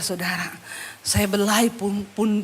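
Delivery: usual speaking voice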